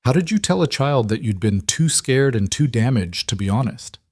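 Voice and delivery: usual speaking voice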